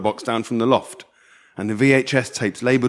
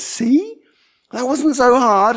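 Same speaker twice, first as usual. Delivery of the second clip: mum's voice; 11 semitones higher, 4 dB louder, slower than usual. Acted. Mum's voice in story.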